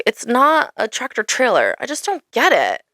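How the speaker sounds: ordinary delivery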